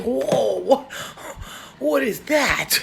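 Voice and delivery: mock shock. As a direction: react with hammed-up alarm. Acts out shock at chin hairs.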